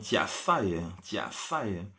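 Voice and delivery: "ciasa" begins with ch, not j; ciasa, not jasa. Shown as a demonstration. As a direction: say "ciasa" with a soft ch at the start, not a j. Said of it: "Bukan jasa ya".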